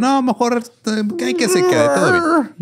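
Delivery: squeaky scared mob, nearly crying; cowardly mob squeaking and whining; high mock whimper. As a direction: back down in a tiny high voice. Mob backing down, mocked.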